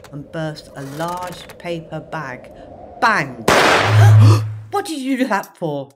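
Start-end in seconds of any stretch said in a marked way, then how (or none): 3.5-5.9 spluttering. Acted. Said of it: "he spluttered".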